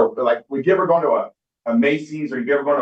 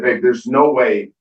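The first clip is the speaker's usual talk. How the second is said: slow, louder, each word leaned on; emphatic disbelief; 5 dB louder, much slower than usual. Retold or imitated. Telling his wife it can't work that fast, slow and emphatic. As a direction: drag it out to insist.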